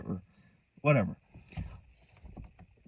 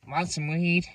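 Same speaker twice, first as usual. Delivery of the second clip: higher-pitched offer in someone else's voice; lightly put-on voice, pitched up; voicing a homeless girl; 6 semitones higher, slower than usual.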